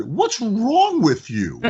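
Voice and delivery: high, slow mock scold; teasing scold. Owner teasing the complaining customer, high and slow. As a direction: tease her like an old friend. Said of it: "I said".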